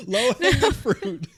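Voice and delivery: high, loud laughing echo; words breaking up with laughter, drawn out. Real, not acted. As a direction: repeat it through laughter. Echoing partner's joke while laughing.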